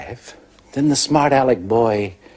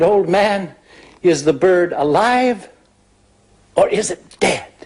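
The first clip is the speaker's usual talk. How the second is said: put-on higher-pitched cocky boy putting a challenge; high-pitched boyish taunt; taunting smart-aleck boy; 7 semitones higher than usual. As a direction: pose it as a dare, voice lifted. Smug boy challenges old man.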